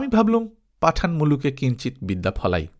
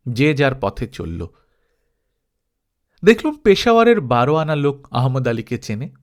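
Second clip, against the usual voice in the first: usual speaking voice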